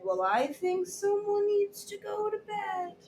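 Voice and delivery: high, soft knowing mother; mom voice. Acted. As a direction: softly coax like a mom. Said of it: "And Mommy said".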